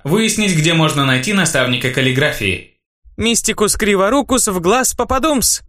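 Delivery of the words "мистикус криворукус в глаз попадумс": high cry of a fake incantation; shouted mock spell. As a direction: call out the spell like a priestess casting. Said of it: "выкрикнула жрица".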